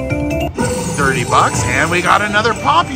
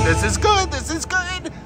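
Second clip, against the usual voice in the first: squeaky delight; 13 semitones higher, 5 dB quieter than usual. Real, not acted. Staying above nine hundred.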